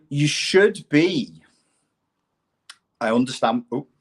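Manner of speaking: ordinary talk, nothing marked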